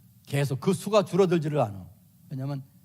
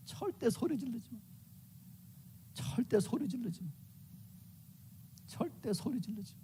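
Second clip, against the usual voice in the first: much softer, hushed warning; soft and quiet, showing how not to yell; softly; 5 semitones higher, 14 dB quieter than usual. Shown as a demonstration. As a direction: say it gently, barely above a murmur. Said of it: "조곤조곤 얘기해요".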